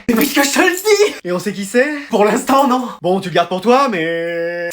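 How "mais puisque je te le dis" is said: high, rising insistence; insistent gossip. Acted. Pupil swearing the gossip is true, high insistent protest. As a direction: press the point, pitch climbing. Élève insiste sur son potin.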